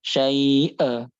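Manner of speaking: SYAI'A held long, then a forced, separated 'a; exaggerated hamzah. Shown as a demonstration. Teacher shows the forbidden reading, overdone hamzah in SYAI'A. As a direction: overdo the catch before the last vowel of "syai'a". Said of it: "nggak boleh".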